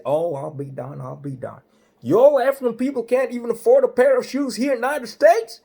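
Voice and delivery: hammed-up highway patrolman; state trooper voice. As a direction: play the big-voiced cop laying down the law. Trooper mocks the bare feet.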